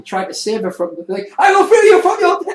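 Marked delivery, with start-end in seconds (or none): 1.4-2.6 very high, very loud hammed-up hero voice; mad heroic declaration. Assassin madly attacking the sister, loud high hero voice. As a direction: declare it with manic glee. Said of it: "attacking her, like, madly".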